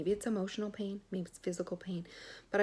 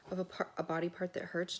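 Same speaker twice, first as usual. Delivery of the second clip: ordinary talk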